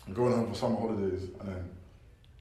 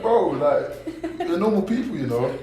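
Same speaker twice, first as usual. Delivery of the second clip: laughing; 8 semitones higher, 8 dB louder, slower than usual. Retold or imitated. Laughing while retelling annoyance.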